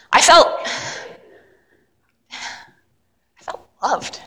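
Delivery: slow, hushed, almost breathless; near-whispered wonder; tender awe. Acted. Tender climax of monologue.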